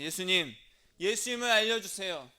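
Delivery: 큰 소리로 예수님께 매달리듯; 목청 높여 간절히 부르기; 간절한 외침. Acted. 설교자가 기도하는 사람을 연기. (크게 외치는 간절한 기도)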